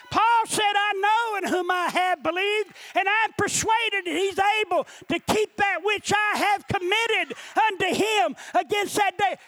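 high-pitched, driving sermon delivery; impassioned preacher in full flow; full-force preaching. Real, not acted. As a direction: preach it with rising urgency.

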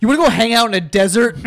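laughing mock question. Real, not acted. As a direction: laugh through a sarcastic question. Mocking, laughing.

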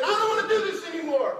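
loud, high, fast outburst; hammed-up frustrated yell. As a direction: blurt it out like a fed-up student. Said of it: "you're frustrated".

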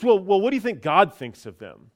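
pushy questioning. Retold or imitated. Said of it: "she kinda kept pushing me".

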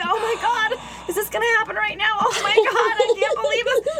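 high panicked near-crying voice; panic, almost in tears. Retold or imitated. Stuck in pickup line, panicking, high near-tears. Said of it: "I was just like".

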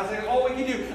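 ordinary delivery

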